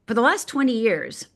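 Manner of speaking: ordinary delivery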